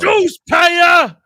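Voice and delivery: high shout. Acted. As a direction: yell it out, high and loud. Shouted bit name.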